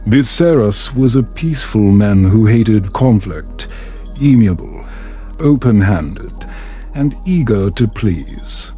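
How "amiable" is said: ordinary delivery, nothing marked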